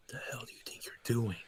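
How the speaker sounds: whispered threat in character; hushed, voiceless confrontation; menacing whisper. Acted. Acted villain confrontation in story.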